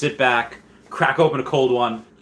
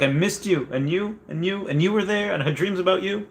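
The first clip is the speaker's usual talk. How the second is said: Rory greeting her drawer; 9 semitones higher than usual. Retold or imitated. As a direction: coo at the sock drawer like a homesick girl. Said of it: "Rory from Gilmore Girls, kisses her sock drawer".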